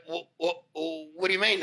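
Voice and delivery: high, stammered bafflement; imitated stutter. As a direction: mimic a flustered boss tripping over the first word. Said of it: "he actually stuttered".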